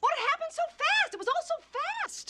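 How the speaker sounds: very high, pleading outcry; distressed protest. Acted. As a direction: protest desperately as if cornered. Cornered witness protests under cross-examination.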